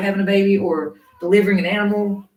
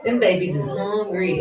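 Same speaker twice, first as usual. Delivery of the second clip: usual speaking voice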